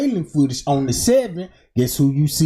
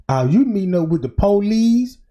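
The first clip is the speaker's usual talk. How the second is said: ordinary talk, nothing marked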